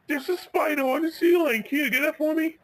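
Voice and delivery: very high, frightened little voice; acted high-pitched fright; scared pleading. Scared Ben asks for help.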